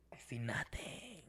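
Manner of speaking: whispered, voiceless retelling; hushed disbelief. Retold or imitated. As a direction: drop to a stunned whisper.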